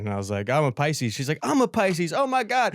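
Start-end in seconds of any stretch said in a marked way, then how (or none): 1.4-2.7 high, gushing imitation of delight; mock excited. Woman finding out they share a sign, mock thrilled. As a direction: squeal it a little, imitating an astrology fan.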